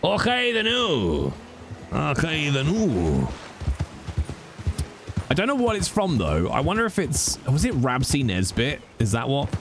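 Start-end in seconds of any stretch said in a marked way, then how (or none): 0.0-3.3 drawn-out Scottish catchphrase; Scottish accent. Shown as a demonstration. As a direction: show off a broad Scottish accent. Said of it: "My Scottish accent is fucking impeccable".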